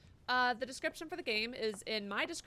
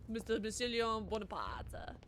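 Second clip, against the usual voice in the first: mock French accent; 3 semitones lower, slower than usual. Mimics the game's French announcer.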